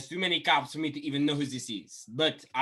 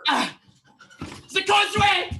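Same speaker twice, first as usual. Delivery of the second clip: put-on a much higher, loud shriek in a Québécois accent; French-Canadian death cry; 8 dB louder than usual. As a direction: scream it as you die. Dying French cadet.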